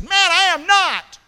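high, loud, slow protest; denial. Acted. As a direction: insist he never knew him. Peter's acted denial.

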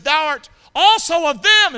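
high, loud, slow accusation; accusing voice. Acted. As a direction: point the finger and call him out. Acted accuser in story.

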